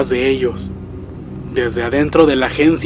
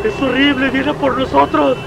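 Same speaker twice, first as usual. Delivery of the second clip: horrified wailing; 12 semitones higher than usual. Acted. Father warning that it is coming for them, wailing. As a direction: wail it in helpless dread.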